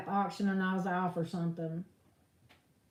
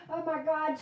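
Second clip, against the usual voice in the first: ordinary delivery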